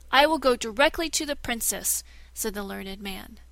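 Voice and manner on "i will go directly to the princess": louder, higher declaration; defiant shout. Acted. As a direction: storm off toward the princess. Learned man defiant.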